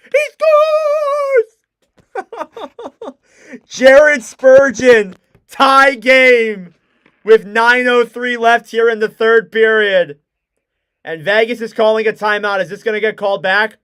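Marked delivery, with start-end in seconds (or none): 0.1-3.1 imitating voice crack. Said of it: "My voice crack too was actually insane". 3.7-12.6 loud, stretched scorer's name; excited re-call. Real, not acted. Commentator redoing the goal call, loud and stretched.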